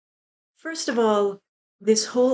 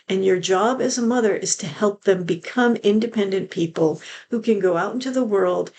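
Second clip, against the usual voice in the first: normal talking voice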